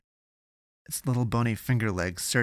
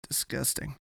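soft muttered aside; mumbled; 5 semitones higher, 7 dB quieter than usual. A narrator's reading. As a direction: mumble it, half to yourself. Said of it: "he mumbled".

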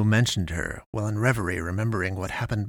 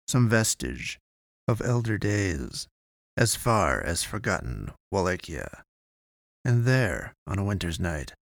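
story narration; 3 semitones lower than usual. A narrator's reading. Narrates a gothic horror story.

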